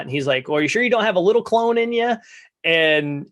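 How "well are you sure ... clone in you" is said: quick higher pickup line. Retold or imitated. Mini-Me's note quoted.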